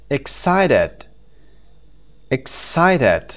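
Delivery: "Excited" with CI punched; ex-CI-ted, loud middle syllable; stress on second syllable. Shown as a demonstration. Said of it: "The second syllable".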